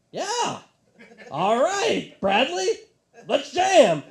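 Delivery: high, loud rock-show shout; loud stage hype. Retold or imitated. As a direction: pump up an empty room. Band hypes the lone fan.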